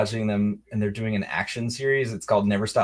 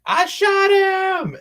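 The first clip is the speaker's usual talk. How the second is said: high, drawn-out proud holler; hammed-up movie impression, loud and stretched; movie quote; 8 dB louder, much slower than usual. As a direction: crow it loudly, stretching every word. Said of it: "when he's like".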